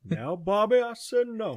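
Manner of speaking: mock Southern dad refusing his son; stern Texan dad. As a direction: shut down the kid's plea. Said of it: "good old Mike Judge".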